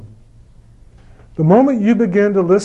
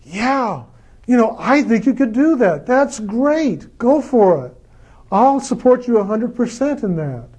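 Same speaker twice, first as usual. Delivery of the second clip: higher, bright-eyed cheering on a friend's goal; higher, eager yes to someone's dream; enthusiastic encouragement; 5 semitones higher than usual. Pretend. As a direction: gush support like a fan. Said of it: "get enthusiastic and go".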